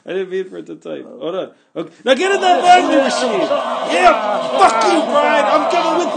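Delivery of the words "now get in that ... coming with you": shrieking high furious yell; furious shout. Acted. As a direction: scream the order in a rage. Villain climax shouting.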